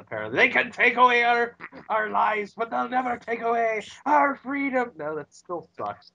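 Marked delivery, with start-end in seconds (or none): 0.4-4.9 put-on Braveheart line in a clumsy Scottish accent; bad Scottish accent. Host quoting Braveheart to prove he can't do Scottish. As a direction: ham up the freedom speech in a shaky Scottish accent. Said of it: "I can't do a Scottish accent".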